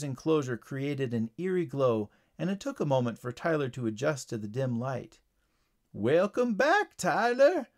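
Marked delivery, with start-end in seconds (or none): 5.9-7.7 high, slow frog greeting; poison dart frog. A narrator's reading. Frog greets the returning boy, slow raised creature voice. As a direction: draw the welcome out in a raised voice. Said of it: "said the familiar voice of the poison dart frog".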